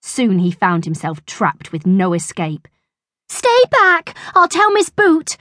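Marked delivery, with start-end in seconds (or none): 3.3-5.4 high, loud cornered schoolboy; acted alarm, lightly panted; panted warning. A narrator's reading. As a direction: warn them off in a high voice. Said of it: "he panted".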